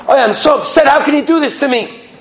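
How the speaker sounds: high-pitched, aggrieved complaint; acted upset father, pitch jumping up; all upset. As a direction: lament it indignantly. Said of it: "is all upset".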